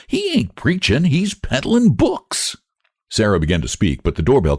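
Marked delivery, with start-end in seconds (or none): 0.1-2.5 Lottie voice. A narrator's reading. Lottie scornful.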